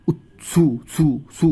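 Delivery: "উৎসু" with tongue touched to the teeth, t running into su; tongue-on-teeth tsu. Shown as a demonstration. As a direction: demonstrate the ts onset of "উৎসু" slowly for learners. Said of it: "দাঁতের সাথে লাগবে". ("উৎসু" বলতে জিভ দাঁতে ঠেকিয়ে ৎসু)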